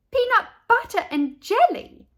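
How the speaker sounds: very high, loud shocked question; astonished. A narrator's reading. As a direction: echo it in shock. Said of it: "Leslie and Natey asked together".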